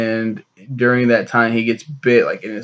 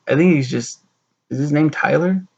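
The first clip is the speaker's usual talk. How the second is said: normal talking voice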